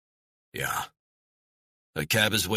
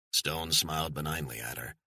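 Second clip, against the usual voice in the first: ordinary delivery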